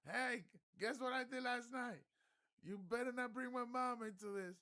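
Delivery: very high, loud yelled exchange; mock yell. Retelling the shouted exchange, high loud yell. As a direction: replay both shouts at full volume. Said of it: "Steven's like".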